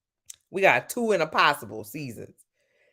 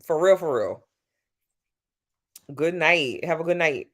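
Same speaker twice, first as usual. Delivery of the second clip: ordinary delivery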